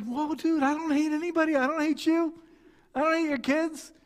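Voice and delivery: high-pitched defensive pleading; startled protest. Retold or imitated. Customer reassuring an offended seller, flustered and defensive.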